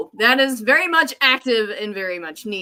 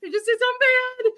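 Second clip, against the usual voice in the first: much higher and very fast, rushed repeat; frantic panic; much faster than usual. Retold or imitated. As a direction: gabble it out in full-blown panic. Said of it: "I'm freaking out".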